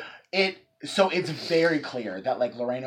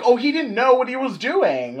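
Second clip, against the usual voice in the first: mock defender; 10 semitones higher, 8 dB louder than usual. Mocking defense of dumb John.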